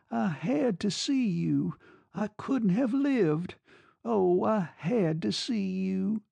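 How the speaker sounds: high, slow, drawn-out plea; pitiful pleading. A narrator's reading. Woman explaining to angry lover why she called, pitiful plea. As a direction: plead brokenly, lingering on every word.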